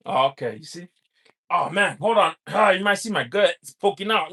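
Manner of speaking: ordinary delivery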